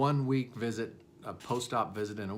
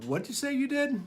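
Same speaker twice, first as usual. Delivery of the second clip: surprised disbelief; 12 semitones higher than usual. Retold or imitated. Retold disbelief at patient's claim.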